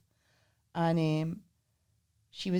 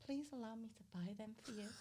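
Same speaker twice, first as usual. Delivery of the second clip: very soft retold plea; old-fashioned gallantry; 22 dB quieter than usual. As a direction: lightly imitate a courtly suitor, keeping it hushed. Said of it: "trying to be old-fashioned".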